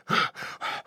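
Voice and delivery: heavy winded exhale; panting breath. Acted. Intern panting after running to the camp. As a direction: pant after a long run. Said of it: "out of breath from his long run".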